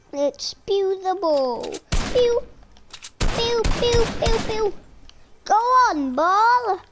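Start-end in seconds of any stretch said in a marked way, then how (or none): 2.1-4.7 high "pew" shots; pretend gun noises. Player shooting at the ball, high gun noises. 5.5-6.8 high, insistent calls; calling out. Pretend. Player ordering the ball outside, calling out.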